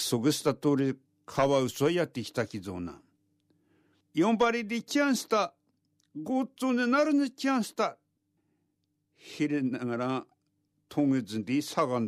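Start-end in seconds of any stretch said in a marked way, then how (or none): 4.1-8.0 high, bright visiting otter; polite otter voice. A narrator's reading. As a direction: call out politely at the door. Otter arrives calling.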